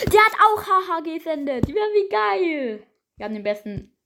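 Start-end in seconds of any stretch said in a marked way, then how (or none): 0.1-2.9 high amused disbelief; excited. Real, not acted. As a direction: squeal it out, delighted. Amused at opponent's reply.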